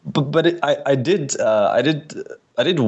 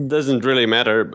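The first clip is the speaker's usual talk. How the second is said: usual speaking voice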